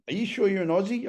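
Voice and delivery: high, teasing question; mocking disbelief. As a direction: needle him like a drinking mate. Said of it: "they said to me".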